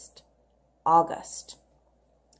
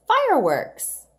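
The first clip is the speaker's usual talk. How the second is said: fireworks higher and louder, with a burst; excited fireworks; 8 semitones higher, 6 dB louder than usual. Shown as a demonstration. Teacher exclaiming fireworks to excite the children. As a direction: announce Fireworks with delight. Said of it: "Here's another compound word".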